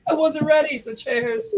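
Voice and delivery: high, bright toast call; cheery toast. Real, not acted. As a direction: raise your glass and call it out. Redoing the toast louder.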